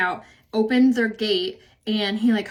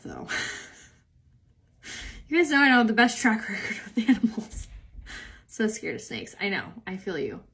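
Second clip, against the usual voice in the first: ordinary talk, nothing marked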